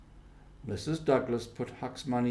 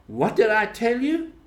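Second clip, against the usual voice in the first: triumphant; 10 semitones higher, 5 dB louder than usual. A narrator's reading. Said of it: "he said".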